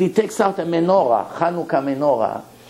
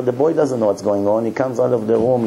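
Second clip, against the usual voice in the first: ordinary talk, nothing marked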